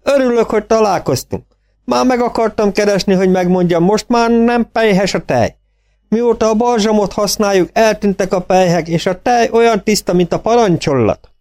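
proud farmer reporting good news. A narrator's reading. (magasabb, elégedett gazda hang)

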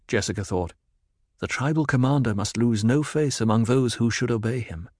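ordinary delivery

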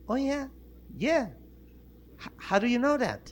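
put-on high-pitched girl's reply; young woman in joke. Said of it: "Le dijo la chava".

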